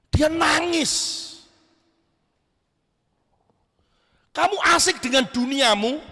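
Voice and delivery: impassioned sermon delivery, rising and falling; preaching. Acted. Preacher challenging a youth congregation.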